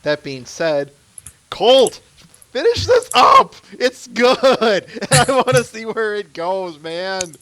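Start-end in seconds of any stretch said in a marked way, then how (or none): 1.5-7.3 high, loud exasperated cheer; loud pleading shout. Real, not acted. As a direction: holler it up high, urging him on. Calling out plea to comic author.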